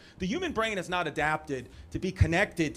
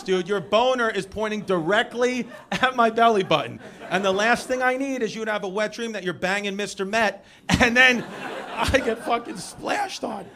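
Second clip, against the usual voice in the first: worked-up comic tirade; exasperated rant; 3 semitones higher, 7 dB louder than usual. Retold or imitated. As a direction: rant indignantly at a buddy, voice raised. Acted rant at friend.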